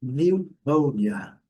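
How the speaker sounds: slow, loud model of "Pneumonia" with a silent P; pronúncia modelo. Shown as a demonstration. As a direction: say "Pneumonia" as niu-MÔU-nia, drawn out for learners. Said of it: "vamos direto à pronúncia". ("Pneumonia" devagar, sem P, soando "niu-môu-nia")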